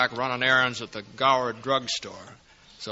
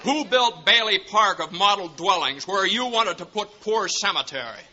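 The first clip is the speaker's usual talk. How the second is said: indignant defiance; 5 semitones higher than usual. Acted. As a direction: stand up to the banker in anger. George confronts Potter.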